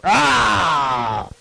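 put-on a sulky kid's low caveman grunt; Neanderthal grunt. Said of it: "It's like some Neanderthal".

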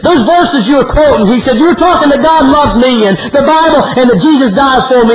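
high, urgent preacher's quote; impassioned pulpit re-enactment, pitched high; agitated retelling. Retold or imitated. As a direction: voice the troubled caller with rising urgency. Troubled man pours out to preacher.

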